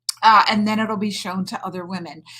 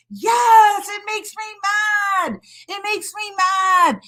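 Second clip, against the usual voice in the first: much higher, emphatic yes; echoing viewer, fired up; 9 semitones higher than usual. Retold or imitated. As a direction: voice a viewer's passionate agreement. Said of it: "Kay's like".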